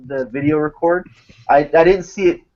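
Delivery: normal talking voice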